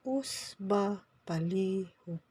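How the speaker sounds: "Usba palihog" slowly, syllable by syllable. Shown as a demonstration. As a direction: draw out "Usba palihog" for learners. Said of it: "I'm gonna say it slower".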